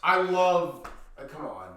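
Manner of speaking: ordinary delivery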